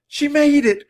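very high, loud, elated cry; thrilled, high-pitched exclamation; joyful cry. A narrator's reading. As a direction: burst out in relief and joy. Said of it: "cried Annie".

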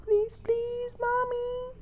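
very high, pleading little voice; begging dog voice. Pretend. Owner voicing her dog begging mommy in a very high pleading voice. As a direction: whine it out like the dog begging to get on the bed. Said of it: "now she's crying 'cause she knows".

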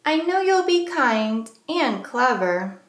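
plain reading voice

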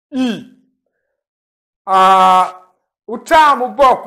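ordinary talk

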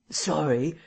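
slightly hoarse reply; a mildly husky, brief reply; a little hoarse. A narrator's reading. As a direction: say it with a touch of hoarseness. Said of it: "said Addie a little hoarsely".

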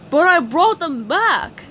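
high, loud cartoon protest; Patrick protesting. A narrator's reading. Patrick returns the eggs to upset SpongeBob, protesting. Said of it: "said Patrick".

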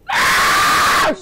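very high, harsh shriek; shrill scream. Real, not acted. Player fleeing the monster, high scream. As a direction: shriek in sudden fright.

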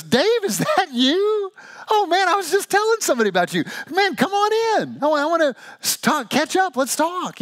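very high, overjoyed, hammed-up voice; excited celebrity greeting. Ken Griffey Junior greeting Dave over the intercom, hammed-up joy. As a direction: gush like a star thrilled to hear an old friend.

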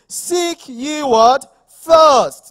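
very loud, slow, high call; shouted call-out. Real, not acted. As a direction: pull the answer from the crowd. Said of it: "calling out to congregation".

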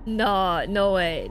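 ordinary talk, nothing marked

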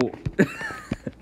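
shrill squealing laugh; high squeal laugh. Real, not acted. Squeal after getting killed.

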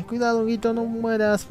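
high-pitched worried cheer for the mushroom; anxious gamer cheering his buddy; excited urging. Real, not acted. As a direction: urge him on, pitch way up.

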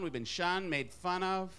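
ordinary talk

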